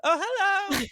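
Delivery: high, bright, overjoyed greeting; enthusiastic hello. Retold or imitated. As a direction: sing out a delighted hello. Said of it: "when you say it overly enthusiastically".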